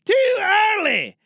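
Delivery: loud high shout; angry cut. Acted. Director shouting that she came in too early. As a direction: shout them down.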